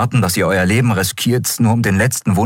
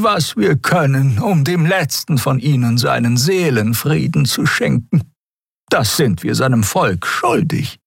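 put-on old wise Xia; 11 semitones higher than usual. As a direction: take your time like an elder.

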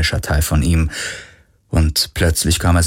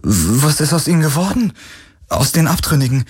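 higher and eager, voice breaking; excited, voice cracking; 8 semitones higher than usual. Acted. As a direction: blurt it out in excitement. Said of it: "Seine Stimme überschlug sich vor Aufregung".

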